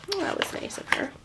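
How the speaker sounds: soft, quick hushed comment; soft, quick hushed aside; whispered aside. Real, not acted. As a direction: whisper it as a little side remark. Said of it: "aside to listener".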